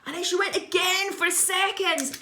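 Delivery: high, drawn-out horrified telling; mock horror. Author reliving the second scoop, high mock horror. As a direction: narrate it in rising melodramatic panic.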